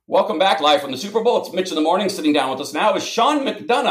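upbeat live broadcast welcome; radio host voice. Retold or imitated. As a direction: announce it like a morning show host. Host's on-air radio intro.

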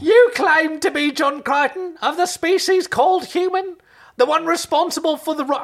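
high, squeaky accusing creature; Dobby. Acted. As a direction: accuse in a loud, high elfin voice. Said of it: "I'm doing Dobby".

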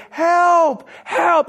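high, drawn-out mock shout; retold cry for help. Man bolting after a four-wheel drive, loud high mock holler. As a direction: call after someone driving away, hammed-up and high. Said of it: "I'm bolting after this four-wheel drive going".

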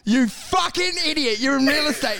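hammed-up indignant telling-off; outraged berating. Bystanders berate the would-be hero doctor, mock outrage.

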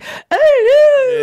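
high, loud mock falsetto; man doing a woman's voice. The host mocks how men fake women's voices, in a high, loud falsetto. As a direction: squeak it out like a man faking a lady. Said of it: "when men try to do a woman's voice".

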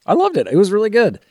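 high, bright enthusiasm; excited. Real, not acted. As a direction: gush about the movie. Enthusiastic verdict, much higher.

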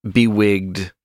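ordinary talk

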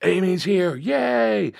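high-pitched imitation of a cheering audience; mock crowd cheer, way up high; crowd freaking out. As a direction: mimic excited fans in a high, drawn-out voice. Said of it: "everybody freaks out".

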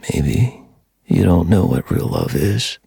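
low, slow gravelly menace; dark whisper behind you. A narrator's reading. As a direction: growl it from the shadows. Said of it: "deep, distorted, and gravelly".

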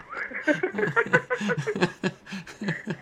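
high, bursting laughter; hearty laugh. Real, not acted. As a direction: laugh at your own story. Laughs at her own story.

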